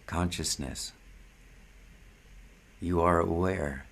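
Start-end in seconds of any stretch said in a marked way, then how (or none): none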